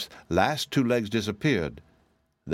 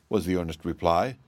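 ordinary delivery, nothing marked

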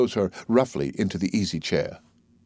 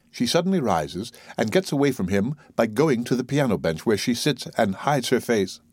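ordinary delivery, nothing marked

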